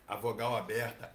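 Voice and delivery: ordinary delivery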